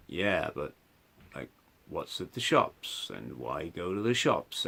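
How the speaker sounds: low, soft, different voice; psychotic inner voice. Shown as a demonstration. Demonstrating his psychotic voice to listener, low and murky. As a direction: make it a low murky voice cutting in. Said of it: "the voice in the middle is saying".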